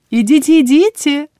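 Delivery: high, loud, merry trill; cheerful singsong. A narrator's reading. Healer cheerfully dismisses the visitors, merry singsong. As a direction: sing it out gaily. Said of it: "весёлым голосом пела Тамплина".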